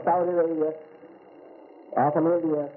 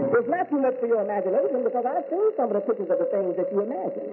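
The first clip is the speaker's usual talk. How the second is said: higher, raised preaching voice; 7 semitones higher than usual. Acted. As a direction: lift it up and preach to the congregation. Preaching on God's oneness.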